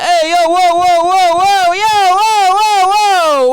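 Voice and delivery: loud, high hammed-up Italian comedian; big Italian whoa, held long and loud; fun Italian. Host shows how the Italian comedian should sound, hammed-up Italian. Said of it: "that's not even the fun Italian".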